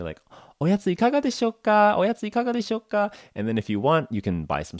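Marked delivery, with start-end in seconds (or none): 0.6-3.1 very high singsong sales call; Japanese train vendor. Acted. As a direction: offer snacks in a high, put-on Japanese vendor voice. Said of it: "on a Japanese Shinkansen, you'll hear".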